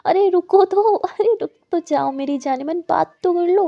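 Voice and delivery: loud, high call to stop her. Acted. Aadya calling after Muskan walking away, loud call. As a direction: shout after her to wait.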